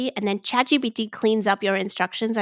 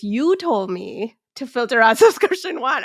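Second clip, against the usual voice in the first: mock-sassy chatbot talking back, a touch louder; 3 semitones higher, 6 dB louder than usual. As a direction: read the bot's complaint with playful sass. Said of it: "I read it with sas".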